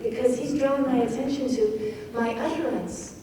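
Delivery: normal talking voice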